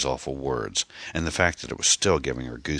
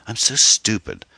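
higher, louder self-reproach; cried out; 8 semitones higher, 7 dB louder than usual. A narrator's reading. Anne blaming herself after the obscene call, crying out. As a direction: cry it out, upset with yourself. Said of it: "she cried".

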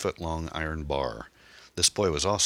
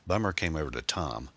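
ordinary delivery

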